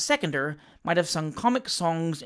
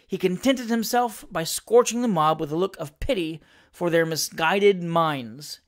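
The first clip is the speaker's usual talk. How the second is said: usual speaking voice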